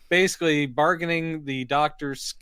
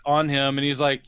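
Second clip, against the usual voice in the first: usual speaking voice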